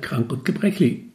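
ordinary delivery

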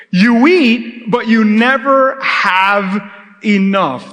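slow, solemn Bible reading; scripture reading. Acted. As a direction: read each verse slowly and gravely. Reads scripture aloud.

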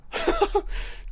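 high, fading laugh; short giggle trailing off. Real, not acted. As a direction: laugh it out softly at the top of the voice. Laughing at the host.

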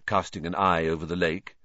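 plain reading voice

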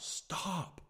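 high-pitched yell without the volume; held-back, drawn-out cry to make it stop; mock yell. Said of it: "I just yelled out".

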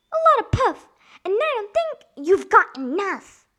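very high, loud pig voice; high-pitched storybook piglet; defiant pig. A narrator's reading. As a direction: taunt the wolf in a squeaky voice. Pig taunts wolf in story.

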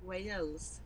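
"Wales" softly with a Welsh twang; Welsh pronunciation. Shown as a demonstration. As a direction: model "Wales" the Welsh way, quietly. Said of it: "You need to say Wales".

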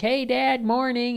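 put-on high, chirpy little boy; young kid voice. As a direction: greet Dad like an eager small kid. Kid greets hockey dad.

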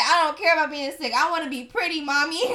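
put-on high, loud whining kid; whiny child. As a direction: whine like an eight-year-old begging mom. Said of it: "Like, I'm eight. I'm like".

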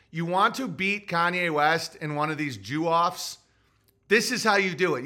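ordinary delivery

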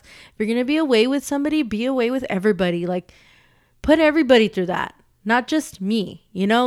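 loud, fast, heated complaint; real anger. Real, not acted. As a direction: demand fairness, fed up. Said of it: "I'm so mad".

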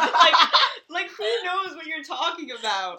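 high, laughing and loud. Real, not acted. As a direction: laugh through the question. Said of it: "laughing at friend's note".

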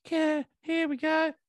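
high squeaky siren then a meek, nervous reply; mock siren and nervous driver. Retelling a police stop to a friend, squeaky high nervous voice.

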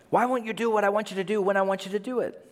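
high, fast whine in one breath; rapid-fire nagging. Acted. Believer nagging Jesus weekly, high and rushed. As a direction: rattle off the demand without pausing. Second, hurried take of the demand.

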